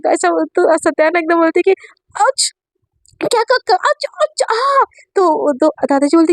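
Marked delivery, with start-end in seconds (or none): 2.2-4.8 much higher pained yelps. Acted. Kicked under the table, squealing ouch in pain. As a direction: cry out as if kicked in the shin.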